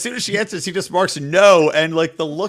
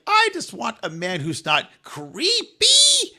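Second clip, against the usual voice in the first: slow, higher, mock-earnest plea; girly earnest voice; 6 semitones higher, slower than usual. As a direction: stretch it out in a playful girly voice. Voicing the girl's dating wish.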